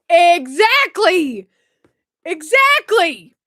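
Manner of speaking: loud, high, long-drawn cry of agreement; emphatic shout. Real, not acted. Streamer agreeing why no one collabs, emphatic drawn-out shout.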